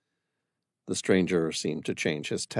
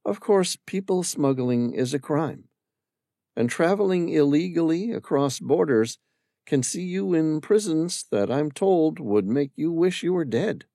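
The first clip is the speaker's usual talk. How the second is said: higher woman's voice with a hidden grin; mischievous Amira; 7 semitones higher than usual. Acted. Amira to Sam, mischievously warning about prisons. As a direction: warn him, half teasing. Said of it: "suppressed a mischievous grin".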